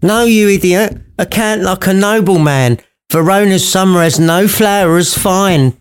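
put-on high, crabby old woman voice; Scrooge's missus. Mother scolding Juliet in a high crabby voice. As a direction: play a cranky old wife. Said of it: "Sounds a bit like Scrooge's missus".

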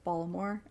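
"Baltimore" softly, T dropped; Baltimore without T. Shown as a demonstration. Showing how locals say it, "Baltimore" with no T. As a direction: say "Baltimore" as "Ballamore", no T. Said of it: "it doesn't have a T in it".

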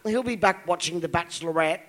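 ordinary talk

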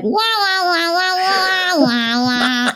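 put-on high wailing wah sounds, like a fussing baby; baby whine demo. Shown as a demonstration. Guest shows how her baby sound started, high wahs. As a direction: play at a whiny baby noise. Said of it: "I was messing around, just like".

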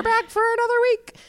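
high playful. Shown as a demonstration. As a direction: pitch the line way up, playfully. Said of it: "only so many ways you can say the same thing".

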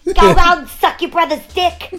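high, loud mock-furious yell; hammed-up screaming insult; movie wife's yell. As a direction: shout it up high like a fed-up wife. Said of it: "what she says in Raging Bull".

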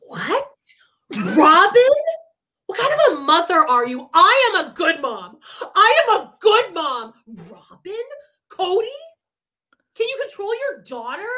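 indignant mom, loud swoops on the retorts; outraged mother defending herself, pitch leaping; acting the mother. Mimicking a mother scolding Robin and Cody, indignant. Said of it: "those are all things she would say".

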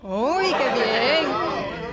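very slow, drawn-out Spanish; Spanish accent. Shown as a demonstration. Shouting something Spanish on request, showy Spanish accent. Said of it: "say it in an accent".

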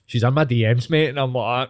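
ordinary talk

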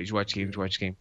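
soft, droning mock nag; mock mumble. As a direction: mutter it like a bored coworker. Mocking coworkers asking.

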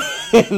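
high-pitched burst of laughter; high squealing laugh. Real, not acted. Laughing at the nan's denial, high squeal. Big laugh after story.

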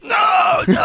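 very high, drawn-out wail; hammed-up high-pitched protest; mock distressed cry. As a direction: wail like a son being force-fed. Son being force-fed bread in retold sketch.

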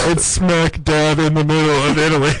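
loud, high, laughing yells; shouting. Pretend. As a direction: bellow the news as a joke. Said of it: "Why is being loud so funny?".

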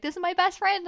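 loud, high mock excitement; gushing reunion. Girl spotting her bathroom friend on the dance floor, excited gush. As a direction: show off your new best friend.

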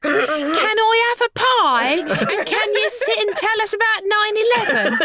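high, drawn-out British kid impression; British child accent. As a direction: play a British child pleading sweetly. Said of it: "two beautiful British cherubic children".

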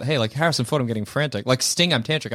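sleazy nudge. Retold or imitated. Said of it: "he nudges you in the side".

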